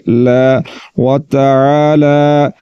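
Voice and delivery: ला व ताला with the la stretched; slow recitation. Shown as a demonstration. Teacher showing the standing fatha lengthening. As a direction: pull ला long. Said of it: "खींच कर पढ़ेंगे".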